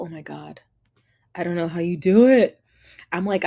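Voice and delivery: normal talking voice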